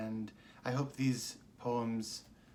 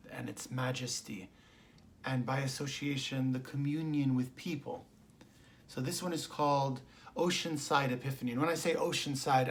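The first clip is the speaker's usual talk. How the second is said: ordinary talk, nothing marked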